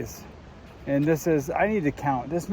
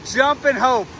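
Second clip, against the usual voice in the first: excited; 6 semitones higher than usual. Real, not acted. Crossing a stream, jumping rocks.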